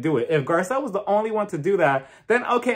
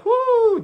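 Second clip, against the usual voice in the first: high whoo; 5 dB louder than usual. Real, not acted. Exclaims about Lisa.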